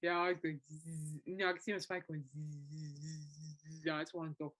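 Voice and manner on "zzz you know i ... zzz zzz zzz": fly buzzing. Retold or imitated. Imitating annoying fly character buzzing.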